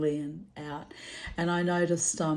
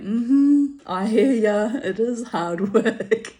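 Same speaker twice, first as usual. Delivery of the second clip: loud, slow, knowing reply; knowing agreement; 5 semitones higher, 8 dB louder, slower than usual. Retold or imitated. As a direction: agree emphatically, drawn out. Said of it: "And I went".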